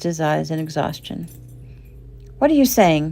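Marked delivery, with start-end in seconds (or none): 2.4-3.1 sharp, louder and higher demand. A narrator's reading. Mother demanding an answer from her son. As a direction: press him hard for an answer. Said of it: "Shelley demanded".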